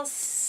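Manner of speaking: long unvoiced snake hiss; steady breathy hiss like a snake. Shown as a demonstration. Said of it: "make that snaky sound".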